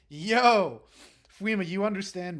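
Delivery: loud excited yo; excited shout. Real, not acted. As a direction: shout it out to the chat. Excited cheer to chat.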